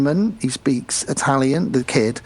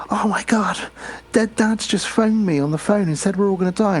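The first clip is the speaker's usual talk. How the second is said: higher, whispered panic; panicked girl; 8 semitones higher than usual. Retold or imitated. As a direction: gasp it out in a panicked rush.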